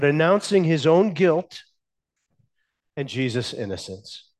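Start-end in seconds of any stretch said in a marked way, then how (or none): none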